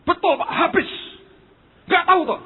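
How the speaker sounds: loud, high insistent confirmation; high-pitched, animated mimicry of a friend; imitating high enthusiasm. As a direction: insist on it with mock excitement. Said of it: "Tetap semangat tinggi".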